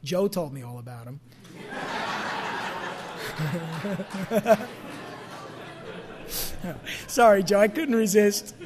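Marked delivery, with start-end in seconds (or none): 1.6-7.0 breathy laugh at his own joke. Real, not acted. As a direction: chuckle at the joke you just told.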